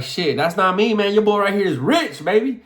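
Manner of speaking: high, punchy comeback; cocky joking swagger; playful bragging. Acted. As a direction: boast it like a hyped-up joke. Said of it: "it might sound like I'm playing".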